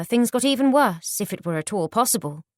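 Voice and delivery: ordinary delivery, nothing marked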